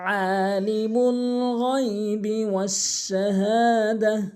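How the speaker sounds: slow, sustained chanting with long-held vowels; Quran recitation. Shown as a demonstration. Teacher opens the Quran lesson with a verse, slow melodic recitation. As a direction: recite it slowly and melodically, stretching each elongation.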